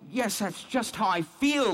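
sudden pained agreement, voice rising; anguished recognition. Real, not acted. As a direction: blurt it out as it hits home. Recognises his own despair.

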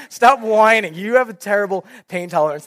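mock annoyed rebuke; exasperated scolding. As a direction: imitate a wife telling him off. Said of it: "throwing stuff at me".

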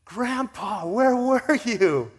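put-on very high, very loud drawn-out child's cry; kids shouting. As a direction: shout it slowly across the room like an overjoyed child. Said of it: "the kids were like".

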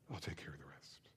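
soft unvoiced whisper of reassurance; whispered Christ. Christ telling the servants to fill the pots, whispered. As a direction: hush it like a quiet promise.

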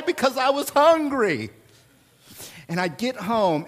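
ordinary talk

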